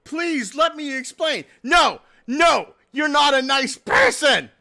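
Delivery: high, loud hammed-up plea; mock frantic pleading. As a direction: beg and then yell like a tantrum. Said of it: "in the chat messages, it's like".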